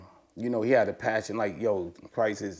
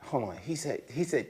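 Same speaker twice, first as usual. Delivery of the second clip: normal talking voice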